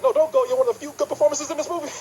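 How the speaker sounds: high, fast pleading voice. Retold or imitated. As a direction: beg her to stay, high. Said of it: "I'm like".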